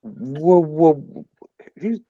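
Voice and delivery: usual speaking voice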